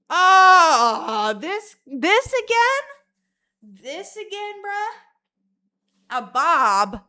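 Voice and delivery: high, loud, stretched outrage; shocked at her own bob idea, voice way up; mock disbelief. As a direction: squeal in horrified disbelief. Reacting to own urge.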